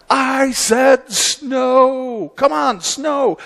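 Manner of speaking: high, stretched-out mock shout; Calvin shouting. Calvin shouts at heaven for snow, high mock yell. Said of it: "he shouts to the heaven".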